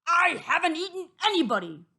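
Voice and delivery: loud, high, rapid wolf protest; indignant wolf yelling; howled protest. A narrator's reading. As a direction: howl your innocence, fast. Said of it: "he howled".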